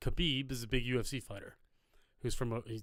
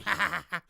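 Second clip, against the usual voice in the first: imitated cackle, higher and louder; Bowser game laugh; 6 semitones higher, 5 dB louder, faster than usual. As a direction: mimic a little video-game villain laughing. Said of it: "Bowser game laugh".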